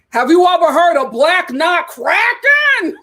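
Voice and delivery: Broadway trainer voice. Retold or imitated. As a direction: deliver it like a Broadway character. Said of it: "he's like".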